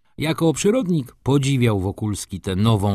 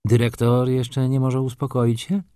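sweet smile; 4 semitones higher than usual. A narrator's reading. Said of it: "ze słodkim uśmiechem Maruszewicz".

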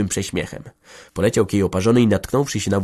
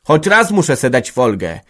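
louder, higher rising rage; building fury; 4 semitones higher, 7 dB louder than usual. Acted. Village head's wife venting her grudge, building to fury. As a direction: let the grievance boil over. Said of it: "ponosiło coraz bardziej".

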